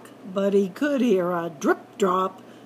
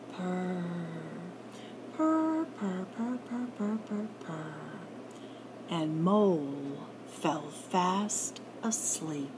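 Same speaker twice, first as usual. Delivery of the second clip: soft fading ending; 4 semitones lower, 8 dB quieter than usual. A narrator's reading. Narrator lulling the listener, soft and fading. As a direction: let it fade to sleep.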